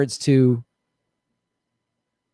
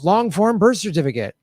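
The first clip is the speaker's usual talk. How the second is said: mocking Obama critics; 5 semitones higher than usual. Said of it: "It was".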